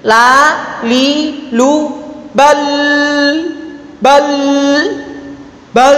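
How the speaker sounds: "bal" with a long, ringing, drawn-out L; fully released L. Shown as a demonstration. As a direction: let the L of "bal" flow on and on. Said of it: "Contohnya begini".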